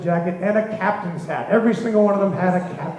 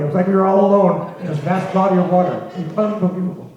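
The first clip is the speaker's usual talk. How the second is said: normal talking voice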